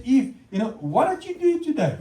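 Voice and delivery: lightly voiced curious God; God asking Eve. God asking Eve about her day, curious. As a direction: friendly question rising high. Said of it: "he went to Eve and he said".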